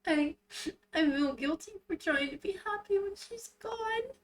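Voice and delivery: very high tearful whine; sobbing. Acted. Rinku confessing guilt over being happy, sobbing. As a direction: wail it out through sobs.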